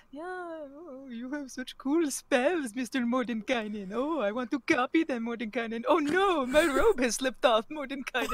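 soft, mocking lovestruck murmur; hushed hammed-up infatuated muttering; muttering to herself. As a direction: croon privately, acting out a swooning daydream. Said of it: "muttering to herself".

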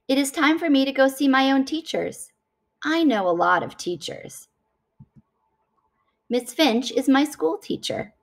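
usual speaking voice

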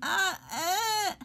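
high pained yelps; pained cries. Real, not acted. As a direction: cry out from the burn. Tongue burning from pepper flakes.

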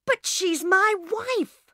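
husband outraged his wife left; angry protest. A narrator's reading. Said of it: "Bill's face was red and angry".

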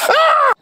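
sudden high yelp of surprise; startled yelp. Real, not acted. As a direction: yelp as the knife slips. Cut slips during carving.

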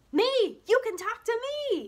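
high, loud mocking boy; laughing tease. A narrator's reading. Meanie Jim teasing Junie B from behind, laughing and loud. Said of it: "he said, very laughing".

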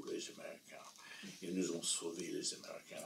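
put-on whispered old lady's gratitude; soft whisper of thanks; hushed. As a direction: breathe it out almost unvoiced.

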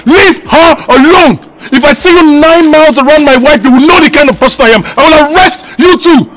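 loud, indignant husband laying down the law; stern angry warning. Acted. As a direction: warn them off your wife forcefully. Husband warns two women off wife.

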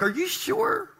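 squeaky disbelief. Retold or imitated. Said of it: "You're like".

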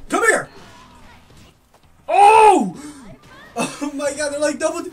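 loud high challenge; yelling at opponent. Real, not acted. Gamer yelling at her opponent, loud challenge. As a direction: bark it at the enemy fighter.

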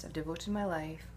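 ordinary talk, nothing marked

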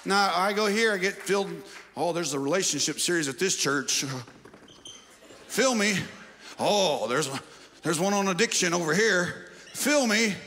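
loud, high, impassioned call over the music; preaching at full force. Acted. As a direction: preach it out, rallying the church. Preacher exhorting congregation over music.

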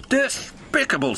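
spluttering. Acted. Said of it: "spluttered Henry".

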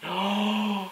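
long, high drawn-in gasp; shocked gasp. Real, not acted. Reading viewers' comments, shocked gasp. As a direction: gasp audibly in surprise.

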